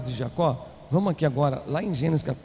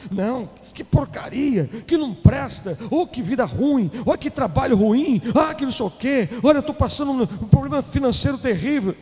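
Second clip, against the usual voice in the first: mock complaining; 6 semitones higher, 4 dB louder than usual. Mocking a complaining believer.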